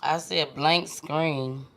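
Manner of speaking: usual speaking voice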